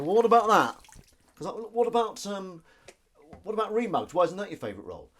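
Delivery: high, loud protesting quote; mock indignation. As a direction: imitate a put-out colleague objecting. Acts offended colleagues' objection.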